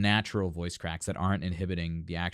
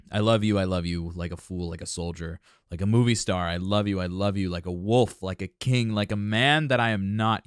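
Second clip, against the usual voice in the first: recited lyrics; 4 dB louder than usual. Acted. Reciting translated love song lyrics.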